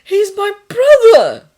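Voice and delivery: loud, high, drawn-out protest; urgent correction. A narrator's reading. Thomas insists to the nurse it's his brother, urgently. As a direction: push it out forcefully and slowly. Choking, urgent cover story.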